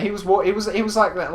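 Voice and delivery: usual speaking voice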